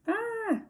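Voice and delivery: sharp, very high scream. A narrator's reading. Leslie suddenly screams in class, very high shriek. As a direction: shriek out of nowhere. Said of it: "Leslie screamed".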